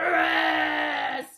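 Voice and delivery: deep, slow shout of the name; loud call. Acted. Lion calling Rhinoceros from the net, deep slow shout. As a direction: boom the name out slowly. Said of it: "Gonna call for Rhinoceros?".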